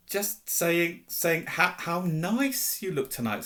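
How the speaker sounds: high, pointed, stammered excuse; flustered cover-up. A narrator's reading. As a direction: cover up hastily while annoyed. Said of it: "said Hermione, glaring at Peeves".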